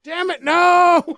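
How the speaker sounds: slow, loud, drawn out damn it no; mock yell. Imitating the neighbor yelling at his fence, slow loud mock yell. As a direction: yell it slow like a fed-up old man.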